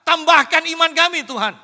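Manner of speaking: high, loud desperate plea; disciples crying out. Retold or imitated. Preacher voicing the disciples crying to the Lord, loud pleading. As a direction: cry out to the Lord for help. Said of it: "para murid masih belum teriak".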